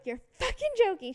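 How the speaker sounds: retold outburst, pitch jumping up; disbelief. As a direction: act out stunned disbelief. Acts out disbelief at friend's warning.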